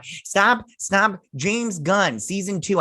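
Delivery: mock scolding. Mock scolding director to camera.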